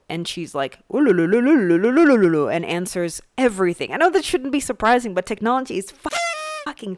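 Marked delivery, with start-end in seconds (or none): 0.9-2.5 loud trilled "lululu" gibberish; loud, mock AI babbling; imitated rapid babble. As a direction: babble a stream of lu-lu syllables like a machine spewing an answer. Imitates AI's rapid babbling reply.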